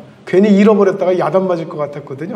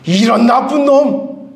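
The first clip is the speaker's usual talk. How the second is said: angry scolding; 4 semitones higher, slower than usual. Shown as a demonstration. Preacher voices the master scolding his servant in blunt everyday words, angry. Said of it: "우리 식으로 하면 어떻게 되는 거예요?".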